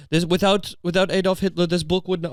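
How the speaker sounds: lightly put-on German accent; mock German-accented voice. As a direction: read it out with a hint of a German accent. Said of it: "I don't know why she's German".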